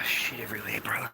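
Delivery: hushed, breathy disgust under his breath; a quiet whispered aside. Real, not acted. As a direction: mutter it low so nobody nearby hears.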